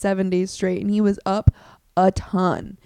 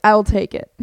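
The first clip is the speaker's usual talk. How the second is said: loud mock decisive; 9 dB louder than usual. Buying statue from cleaner.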